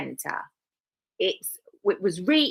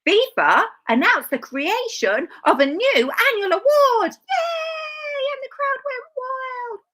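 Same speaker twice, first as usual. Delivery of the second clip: much higher, loud hammed-up cheer with a shouted yay; mock excitement; 4 dB louder than usual. Sarcastic host hyping FIFA's new award in hammed-up mock excitement. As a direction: gush like a sarcastic game-show host.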